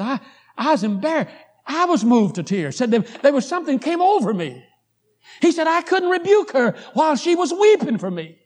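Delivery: high, loud testimony from the pulpit; impassioned preaching. Acted. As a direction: preach the story with mounting force. Preaches husband's testimony with force.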